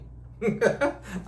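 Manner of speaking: airy laugh up high; high breathy laugh. Real, not acted. Guru laughing at his no money no honey joke. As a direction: laugh at the rhyme you just made.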